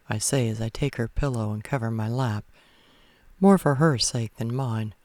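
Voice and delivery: plain reading voice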